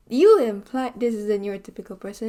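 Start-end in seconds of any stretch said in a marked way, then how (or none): 0.1-0.9 mock accusation. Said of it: "where it's like".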